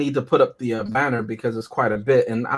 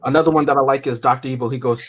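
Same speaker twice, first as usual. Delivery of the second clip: normal talking voice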